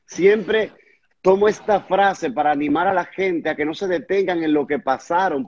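usual speaking voice

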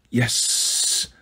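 hissed snake yes; reptilian voice. Acted. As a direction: hiss it out like a reptile. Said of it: "a second reptilian voice said".

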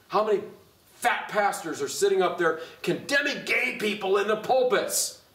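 emphatic, building pulpit challenge; indignant preaching. Acted. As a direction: throw it out as a forceful accusation. Preaching at full force.

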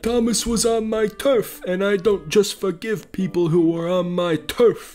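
a hint of a menacing Italian mobster; Italian mob accent. [name] threatens Thomas over his turf in a light mob accent. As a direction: threaten him like a mafia boss, lightly voiced. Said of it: "speak in this accent because I'm [name]".

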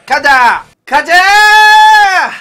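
rising to loud hyped shouts; psyching himself up for the big rush; hype shout. Real, not acted. Streamer hypes up a gambling rush.

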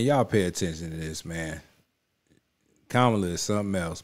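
ordinary delivery

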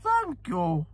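very high, regal put-on voice; Queen Mother. Royal thanking an admiring racegoer, imitated high. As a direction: imitate a royal's high-pitched gracious reply. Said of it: "so I'll do the voice".

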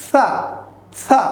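সা with air released lightly between the teeth; airy dental sound. Shown as a demonstration. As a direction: let breath through the teeth on সা. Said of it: "হালকা দাঁতের মাঝখান দিয়ে বাতাস ছেড়ে দিয়ে". (দাঁতের ফাঁকে বাতাস ছেড়ে সা)

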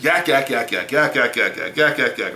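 mock yakking in an unbroken, even run; imitating nonstop chatter. Preacher imitating women who talk over him, mock chattering. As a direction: rattle off a mouthy person's jabber. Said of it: "they got a whole lot of mouth".

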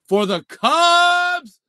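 loud, high, drawn-out cheer; big hyped-up announcement; excited call-out. Real, not acted. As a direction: belt out the team name and hold it. Calls out the next card.

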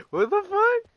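high shocked "What the fuck?"; shocked squeak. Real, not acted. Streamer shocked at the game, high squeak. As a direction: pitch it up in disbelief.

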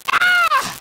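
sudden high ecstatic shriek; ecstatic cry. Real, not acted. Preacher seized by joy before the church, ecstatic cry. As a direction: burst out in a piercing cry of rapture. Ecstatic outburst mid-sermon.

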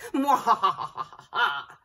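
loud villainous cackle; evil laugh. A narrator's reading. Evil pea laughing at the vegetables. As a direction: cackle like a baddie.

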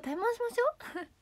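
mock challenge. Mock challenge, repeated higher.

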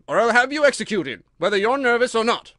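loud, high, angry threat. A narrator's reading. King threatens the nervous Hatter with execution, loud and angry. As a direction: threaten him furiously at full voice. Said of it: "the king repeated angrily".

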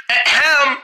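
pointed throat clear for attention; throat-clearing ahem. Acted. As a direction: clear your throat to be noticed. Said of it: "What do you mean, ahem?".

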